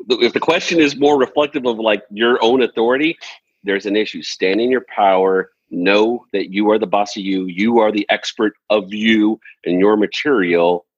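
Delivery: ordinary talk, nothing marked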